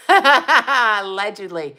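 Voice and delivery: slow, laughing "Allegedly"; knowing laugh on "Allegedly". Shown as a demonstration. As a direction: drag out "Allegedly" through a laugh. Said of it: "says allegedly twice".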